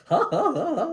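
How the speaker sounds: high mock laugh, pulsed ha-ha-ha; imitated comedian laugh. Host laughing at his own joke, high imitated laugh. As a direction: mimic a host's signature laugh. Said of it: "his laugh is the reason".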